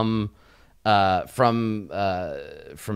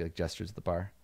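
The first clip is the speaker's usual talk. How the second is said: ordinary talk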